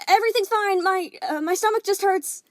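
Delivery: high, loud forced cheer; strained shout. Acted. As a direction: shout through the door, faking fine. Calling out through the door.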